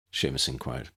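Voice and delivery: usual speaking voice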